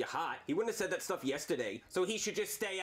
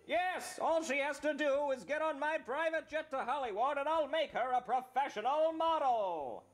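schmoozy Hollywood agent pitch; Steve Staleberger voice; 8 semitones higher than usual. Acted. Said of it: "talent agent Steve Staleberger".

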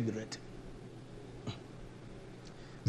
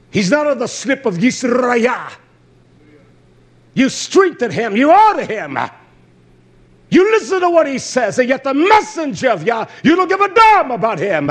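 high, loud scolding sermon; fiery preaching; 8 semitones higher, 6 dB louder than usual. Acted. Preacher rebuking Israel for honoring wicked sons. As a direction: rebuke the flock hard.

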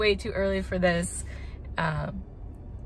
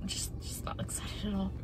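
whispering; 10 dB quieter than usual. Real, not acted. Dreading dentist, whispered.

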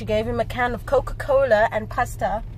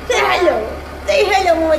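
lightly raised coaxing, word by word; teaching a parrot; 4 semitones higher, 6 dB louder, faster than usual. Real, not acted. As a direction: coax the bird to repeat. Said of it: "teaching the parrot to talk".

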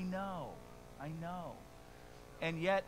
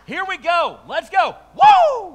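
much louder and higher, whooping at the end; pumped-up cheer; 12 semitones higher, 17 dB louder than usual. Pretend. Prophet cheering that God's answer is coming, hammed-up excited whoop. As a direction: hype yourself up like a fan before the big game. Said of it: "Habakkuk is like".

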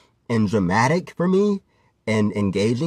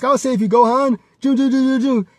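higher, louder hammed-up Krillin cry; a hero's hammed-up rescue shout; mock Krillin; 10 semitones higher, 4 dB louder than usual. Krillin rushing in to save Gohan, mock heroic shout. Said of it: "Krillin comes in with the Destructo Disc".

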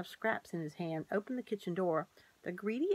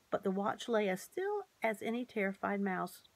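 plain reading voice

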